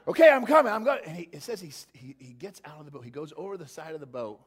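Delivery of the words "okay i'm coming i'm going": louder, psyched self-hype; talking himself into the jump; pumped-up Peter. Acted. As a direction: Peter hyping himself to step out. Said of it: "I can just see him like".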